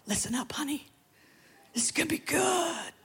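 hushed, eager whisper to the neighbour; conspiratorial whisper. Pretend. Nudging the person next to you, a playful whisper. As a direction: lean in and whisper the juicy bit.

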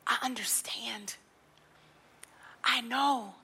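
soft whispered voice of God, low and hushed; still small voice. Acted. Preacher voicing God to the weary, hushed whisper.

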